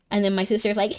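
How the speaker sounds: normal talking voice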